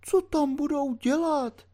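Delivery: put-on high frightened old woman's voice; alarmed old landlady. A narrator's reading. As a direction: panic as the worried landlady. (vyděšená stará posluhovačka)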